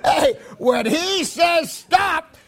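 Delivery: high, loud, drawn-out shout; slow build into a shouted STOP; emphatic shout. Real, not acted. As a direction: belt out the command to the crowd. Preacher shouts the punchline.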